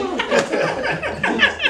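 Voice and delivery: very high squealing laugh; real laughter. Real, not acted. Laughing fit continues.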